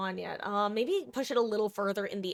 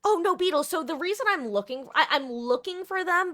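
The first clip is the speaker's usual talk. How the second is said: alarmed; 9 semitones higher, 6 dB louder than usual. Real, not acted. Alarmed at chat.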